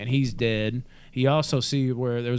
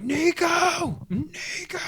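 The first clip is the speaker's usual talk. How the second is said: mock shriek, much higher; screaming like a bitch; much slower than usual. Podcaster imitating the panicked villain screaming a name. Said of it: "screaming like a bitch".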